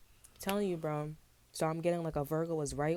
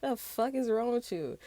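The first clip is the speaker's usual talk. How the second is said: higher, slower, laughing disbelief; incredulous; 8 semitones higher, 5 dB louder than usual. Retold or imitated. Reader mocking a strange greeting, laughing and incredulous. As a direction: laugh out a stunned what's wrong with you. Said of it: "I was like".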